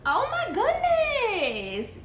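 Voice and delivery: slow, high laughing "oh my goodness". Real, not acted. Host cracking up at a viewer's question, slow and high. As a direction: stretch it out through a laugh.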